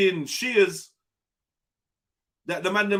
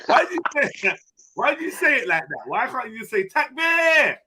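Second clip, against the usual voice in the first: ordinary talk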